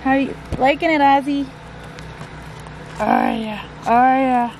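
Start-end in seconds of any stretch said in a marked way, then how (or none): none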